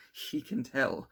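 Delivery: terrified cry. A narrator's reading. Victor sees the monster glide in, panicking. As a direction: blurt it out in fear.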